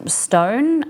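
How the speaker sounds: ordinary talk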